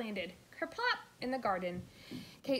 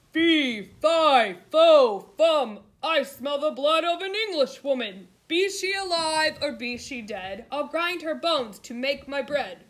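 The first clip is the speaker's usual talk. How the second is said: loud, higher giant's chant; booming giant; 5 semitones higher, 14 dB louder than usual. A narrator's reading. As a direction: bellow each word like a hungry giant.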